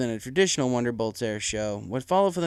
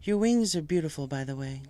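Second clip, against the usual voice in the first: Celestia complimenting; 5 semitones higher than usual. A narrator's reading. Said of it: "Celestia complimented".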